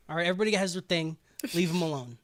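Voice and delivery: usual speaking voice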